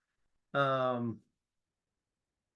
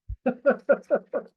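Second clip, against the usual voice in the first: amused laugh; 12 semitones higher, 10 dB louder than usual. Real, not acted. Teacher laughing at himself.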